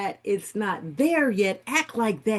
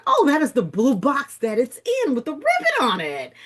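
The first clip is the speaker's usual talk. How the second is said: delighted at the box; 7 semitones higher, 5 dB louder than usual. Retold or imitated. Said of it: "And I said".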